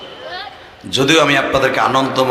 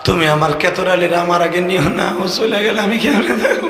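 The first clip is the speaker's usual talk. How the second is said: mock pleading prayer; 8 semitones higher than usual. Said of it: "এই দোয়া করবা".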